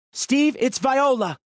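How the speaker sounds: high, slow call. A narrator's reading. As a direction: call out across the room. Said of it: "Holly called".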